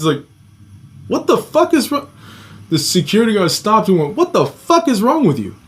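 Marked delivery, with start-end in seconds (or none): 1.1-2.1 high-pitched, retold incredulous challenge; outraged disbelief. Guard cutting off the strangler's excuse, outraged disbelief. 4.2-5.5 high-pitched, imitated scolding; outraged disbelief. Security guard confronting the man who strangled a child, outraged disbelief.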